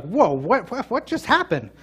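sudden confused rise, pitch jumping high on the last what; a startled, baffled outburst; bewildered whoa. Retold or imitated. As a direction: caught off guard mid-argument. Acted confusion in conflict.

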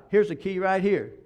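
usual speaking voice

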